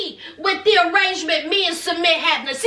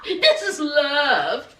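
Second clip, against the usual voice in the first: 1 slow sigh; 4 semitones lower, much slower than usual. Retold or imitated. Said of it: "She says".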